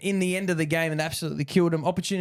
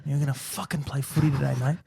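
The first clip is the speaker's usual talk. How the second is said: a hint of a gruff coach grabbing a player; lightly voiced tough coach, no accent; 3 semitones lower than usual. Coach to a player, a lightly voiced gruff pep talk. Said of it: "I tried to not put an accent".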